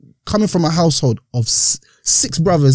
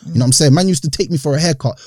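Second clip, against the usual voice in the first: ordinary talk, nothing marked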